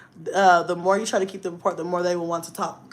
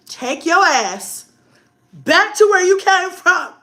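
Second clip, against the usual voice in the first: loud, high-pitched telling-off, drawn out; retold angry order; 11 semitones higher, 8 dB louder, slower than usual. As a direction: act out yelling a kid away. Retelling telling off the boy.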